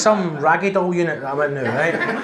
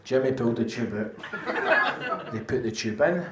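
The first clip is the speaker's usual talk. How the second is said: slow, lower deadpan recital; 7 semitones lower, 6 dB quieter than usual. Acted. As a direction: drag it out for the punchline. Slow deadpan repetition punchline.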